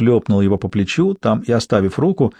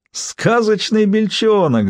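high, drawn-out mocking exclamation; mock amusement; 10 semitones higher, much slower than usual. A narrator's reading. Julian repeats his friend's nickname, high, drawn-out amusement. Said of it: "Джулиан зашёлся смехом".

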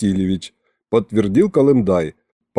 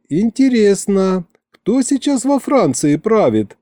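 higher-pitched, thoughtful woman; pensive drawl; 9 semitones higher than usual. A narrator's reading. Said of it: "задумчиво протянула Маша". (задумчиво, протяжно, повыше)

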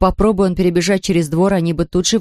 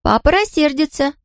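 put-on high-pitched little girl voice; girl's voice; 9 semitones higher than usual. A narrator's reading. Marlotta's line in girl voice.

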